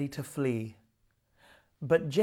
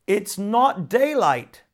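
loud, slow, higher shout; 6 semitones higher, 7 dB louder, slower than usual. Acted. Water devil shouts at Nora about the daylight, loud slow shout. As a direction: bellow it as a furious water devil. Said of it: "shouted the water devil".

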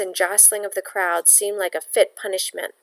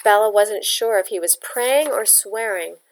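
ordinary delivery

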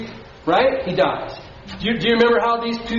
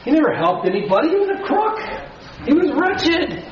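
mock-outraged churchgoer, pitched higher; indignant skeptic; 7 semitones higher than usual. Pastor mimics listeners objecting to the thief's pardon, higher and scoffing. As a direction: scoff at the unfairness, half laughing. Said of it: "Some of you said".

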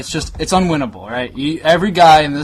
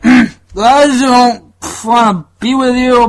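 high, loud, very slow slurred quote; imitating a drunk friend breaking up; mock drunk boyfriend; 9 semitones higher, 8 dB louder, much slower than usual. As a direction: drag it out like a drunk. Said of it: "He was just like".

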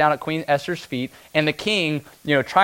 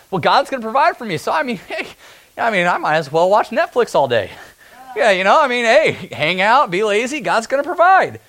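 higher, louder hammed-up slacker; mock lazy; 7 semitones higher, 4 dB louder than usual. Preacher mocking lazy Christians, hammed-up slacker voice. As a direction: lampoon the lazy believer with a laugh.

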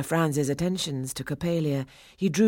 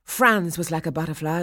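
usual speaking voice

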